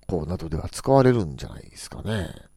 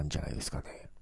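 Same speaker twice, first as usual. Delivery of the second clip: whisper; 9 semitones lower, 15 dB quieter, faster than usual. Real, not acted. Fading into a whisper.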